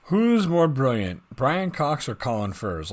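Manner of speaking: loud, drawn-out showman exclamation; excited. Real, not acted. Host hypes a comments-section question with loud delight. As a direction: boom it out like a TV host teasing the audience.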